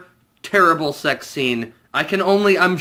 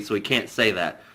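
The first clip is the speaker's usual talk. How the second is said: ordinary delivery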